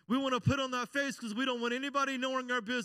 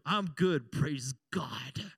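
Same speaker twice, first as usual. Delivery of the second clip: low, drawn-out pious answer; hurting praise God; 10 semitones lower, much slower than usual. Acted. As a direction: cover the hurt with a heavy "praise God". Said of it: "The emphasis on the praise God is how bad I'm hurting".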